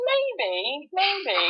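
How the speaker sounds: high, drawn-out sing-song maybe; teasing high-voiced admission; coy retold reply. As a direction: play it coy and noncommittal, pitched high. Coyly answering mum's teasing, retold.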